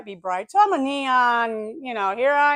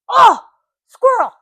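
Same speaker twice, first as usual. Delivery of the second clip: high, loud startled outburst; sudden yelp of discovery, as a dog would; mock frantic dog excitement; excited dogs; 13 semitones higher, 12 dB louder than usual. Dogs spot a squirrel.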